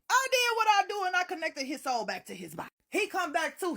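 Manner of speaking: ordinary talk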